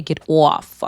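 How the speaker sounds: ordinary delivery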